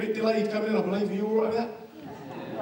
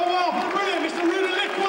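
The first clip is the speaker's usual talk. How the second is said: cheering intro; 11 semitones higher than usual. Shown as a demonstration. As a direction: show the crowd how to cheer. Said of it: "Really clap and cheer and go".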